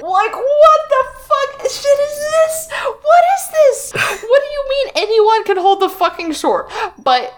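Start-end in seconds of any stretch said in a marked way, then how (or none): 0.0-5.9 high, slow outraged shout; disbelieving high-pitched outcry; incredulous outrage. Real, not acted. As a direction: yell at the absurd book. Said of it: "yeah, I'm angry".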